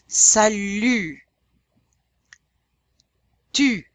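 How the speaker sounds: "Salut" and "Tu" with ee through rounded lips; French U. Shown as a demonstration. Teacher gives examples, "Salut" and "Tu" with rounded U. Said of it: "we're gonna still say E".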